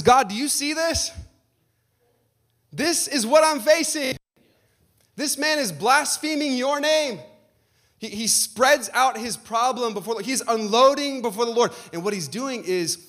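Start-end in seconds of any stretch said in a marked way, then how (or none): 0.0-7.2 much higher, slow, pleading; desperate prayer. Retold or imitated. Hezekiah showing God the threatening letter, high and pleading. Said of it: "he spreads it out and he says".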